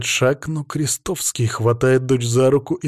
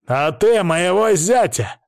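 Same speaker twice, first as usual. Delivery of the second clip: higher, slow angry retort; angry bark; 7 semitones higher, 3 dB louder than usual. A narrator's reading. Krestovsky throws an accusation back at his accuser, angry bark. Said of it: "рявкает Крестовский".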